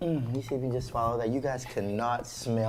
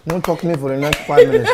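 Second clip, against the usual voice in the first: excited; 4 semitones higher, 12 dB louder than usual. Real, not acted.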